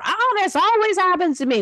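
a child playing the martyr, wailing to the room; martyr whine. High self-pitying martyr wail. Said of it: "or playing the martyr".

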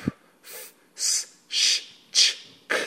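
f, s, sh, ch, k breathed out with no voice; just air for each sound, no voice behind it; unvoiced sounds. Shown as a demonstration. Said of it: "los sonidos ya mencionados de unvoiced". (sonidos sordos, solo aire)

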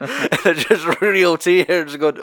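laughing through the words, slow. Real, not acted. Reviewer amused by the slapping scene, laughing. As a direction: giggle out the question. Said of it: "reviewer laughing at the scene".

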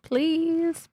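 high, pleading, pouting please; pouty please. Retold or imitated. Girl pouting at the doctor to get her way, pleading. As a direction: beg with a big pout. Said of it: "pouty face".

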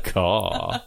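low laughing caw; crow caw. Acted. Bird form joke.

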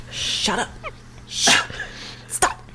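hushed, breathy shut up; whispered shush. Acted. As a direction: hiss it at him quietly. Fifi hushing him.

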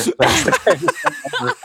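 high falling laugh. Real, not acted. Laughing at his own meek thanks, high laugh. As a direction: let a high laugh tumble down.

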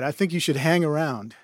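usual speaking voice

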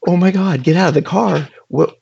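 panicked, pitch shooting up; retelling a panic moment, voice jumps up; freaking out. Retold or imitated. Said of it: "I'm totally freaking out".

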